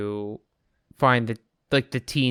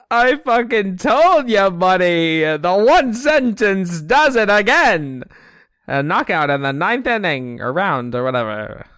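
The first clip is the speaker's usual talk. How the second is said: mid-century Atlantic voice, loud and slow; loud carnival barker; 7 semitones higher, 6 dB louder, slower than usual. Acted. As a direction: bellow it like a fairground barker. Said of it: "You sound like a carnival barker".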